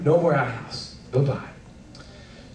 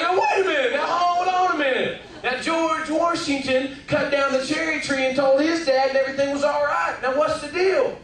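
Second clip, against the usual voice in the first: put-on pleading kid; 10 semitones higher than usual. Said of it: "And I was like".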